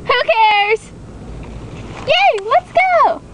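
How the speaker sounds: put-on high, slow, chirpy girl; high girly voice. Girl not caring her friend vanished, high and chirpy. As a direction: sing out the yay like a carefree girl. Girly character cheerfully moves on.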